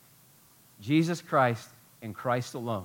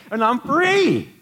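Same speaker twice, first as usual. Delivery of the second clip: high, loud, drawn-out cry of freedom; exultant preacher shout; jubilant release; 9 semitones higher, 7 dB louder, much slower than usual. Acted. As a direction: proclaim it, stretching out the last word. Acting out release from shackles.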